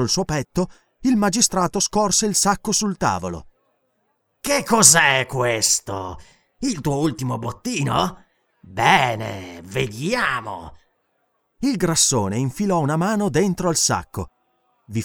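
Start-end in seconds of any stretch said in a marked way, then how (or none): 4.4-10.7 slow, sly drawling curiosity; smug magistrate. Acted. Magistrate eyeing Conan's sack, sly and smug. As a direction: savour the prize before grabbing it.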